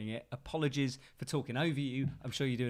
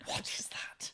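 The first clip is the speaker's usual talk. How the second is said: whispered quote; 6 dB quieter than usual. Retold or imitated. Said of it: "just like".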